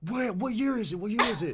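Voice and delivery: high, bewildered mock panic; freaking out. Tripping stranger questions the band, freaking out. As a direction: imitate a tripping stranger who has lost track of time. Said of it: "he's freaking out".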